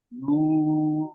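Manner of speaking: ordinary talk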